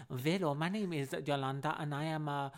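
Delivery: botched German accent drifting towards mock Chinese; German accent turning Chinese. Shown as a demonstration. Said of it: "it goes into, like, some Chinese, like, voice".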